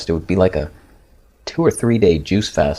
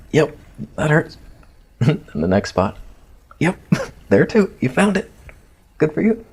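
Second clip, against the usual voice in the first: higher, laughing mock cheer through the pain; sarcastic pain; 6 semitones higher than usual. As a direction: congratulate the doctor sarcastically while he presses. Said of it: "I'm like".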